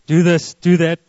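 loud, commanding divine voice. Acted. God ordering a listener about, in a loud voice. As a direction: boom out each short order. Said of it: "It's not always a loud voice".

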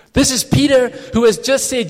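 ordinary talk, nothing marked

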